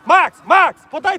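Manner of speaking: loud call to a far player. Real, not acted. Coach calling his player across the field, loud. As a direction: yell his name to grab attention.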